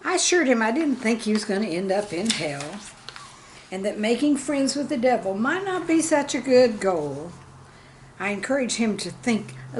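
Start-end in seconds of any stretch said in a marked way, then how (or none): none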